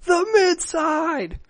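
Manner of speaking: high, slow, bellowing movie yell; Gerard Butler yell. Pretend. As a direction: bellow it like an action hero. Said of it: "can we just have him yell".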